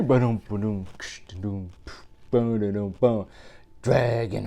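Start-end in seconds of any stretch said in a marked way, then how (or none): 0.0-3.3 quiet mouth drumbeat; beatbox the drums softly; soft vocal drumming. Acted. Reader drums the refrain quietly for the kids.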